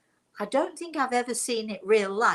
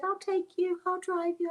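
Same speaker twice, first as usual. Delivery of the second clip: quoting friend; 10 semitones higher than usual. Retold or imitated. Friend offering a ride, quoted.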